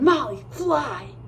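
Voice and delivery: urgent, slow warning cry; boy shouting a warning to Molly; shouted warning. A narrator's reading. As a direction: yell for her to get away.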